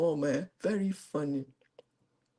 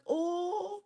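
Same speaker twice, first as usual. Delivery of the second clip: acted stunned reaction; 13 semitones higher, 7 dB quieter than usual. Acts out hiding shock at news.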